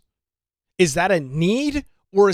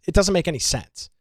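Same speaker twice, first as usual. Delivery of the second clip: ordinary talk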